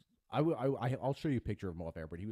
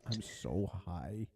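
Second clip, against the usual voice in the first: lazy stoned drawl; much slower than usual. Retold or imitated. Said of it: "She's like".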